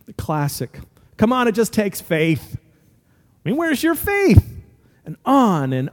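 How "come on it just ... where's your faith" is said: imitating a chiding believer, rising high at the end; mock reproach. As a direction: lightly scold them in disbelief.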